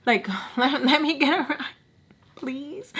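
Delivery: high, softening retold plea; pleading. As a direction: plead small and high, fading on please. Pleading for a ride.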